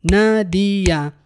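نادية slowly in three beats, na-di-ya; three clapped syllables. Shown as a demonstration. Teacher slowly claps نادية in three beats for the class. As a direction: clap three times on نادية, na di ya. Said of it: "نصفق ثلاث صفقات".

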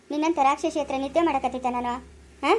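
normal talking voice

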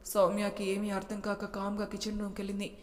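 ordinary delivery, nothing marked

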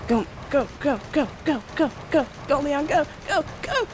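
urgent high chant; rising panic, push him on; frantic cheering. Real, not acted. Cheering character Leon to flee.

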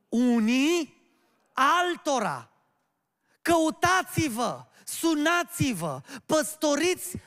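slow, loud, high-pitched exhortation; forceful preaching. Real, not acted. Preacher urging the flock to care for one another, forceful preaching. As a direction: hammer out each command separately.

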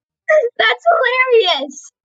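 high, long-drawn mock crying shout; mock wail. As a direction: wail it out in fake despair. Sarcastic reaction to quiz answer.

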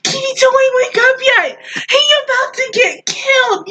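high-pitched mock-anxious warning; imitated urgent plea. Viewer begging Kitty not to wake up, imitated urgent plea. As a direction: plead high, retelling a worried viewer. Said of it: "You're like".

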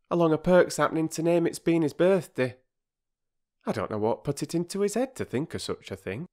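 high, fast, chattering housewife; mother apologising to the children for being late; flustered working-class mother. Acted. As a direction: rush the apology out. Mrs Perks, flustered.

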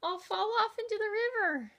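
the ladybug grumbling about the roaring tiger, high and whiny; squeaky ladybug. A narrator's reading. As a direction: drag out the complaint in a tiny voice. Ladybug character voice.

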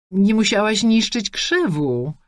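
slow, lecturing counsellor voice; mild preachy teacher tone, slow; school counsellor tone. A narrator's reading. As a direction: scold like a school pedagogue. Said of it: "tonem pedagoga szkolnego".

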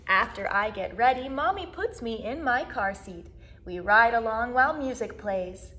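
usual speaking voice

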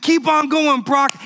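high, loud urging; cheering on. Retold or imitated. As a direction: push him forward with every word. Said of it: "Brock is saying".

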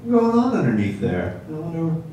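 high-pitched, soft mock wondering; curious. Man curious about long dresses, high mock-wondering voice. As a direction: wonder aloud in a small high voice. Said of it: "just, like, curious".

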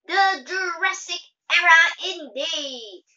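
high, loud and slow, haughty; high superior voice. A narrator's reading. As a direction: declare it grandly as class president. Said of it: "in a high superior voice".

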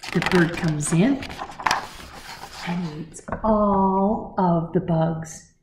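plain reading voice

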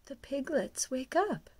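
ordinary delivery

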